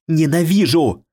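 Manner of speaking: high furious scream. A narrator's reading. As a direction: hurl it with hatred. Ghost screams at killer.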